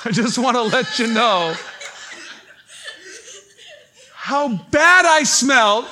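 loud laughing, drawn out, rising high; laughing loudly. Real, not acted. Confessing to the church how bad he smelled, laughing loudly. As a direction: crack up as you confess it.

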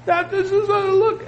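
mock outraged shout; incredulous outcry. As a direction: cry out in disbelief. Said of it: "And people said".

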